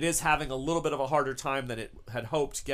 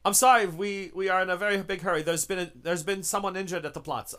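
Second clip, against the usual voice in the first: broken English; 5 semitones higher, 3 dB louder than usual. Acted. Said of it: "very broken English".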